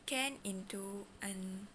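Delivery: slow, halting delivery; slow mode. Shown as a demonstration. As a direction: say each word slowly. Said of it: "Slow mode".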